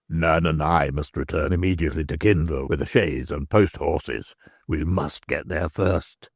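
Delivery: a worried man swearing under his breath; Stracey's voice. Acted.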